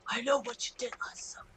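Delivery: whispered movie-line menace; spooky whisper. Retold or imitated. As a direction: whisper it like a horror tagline. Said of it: "Then I'm like".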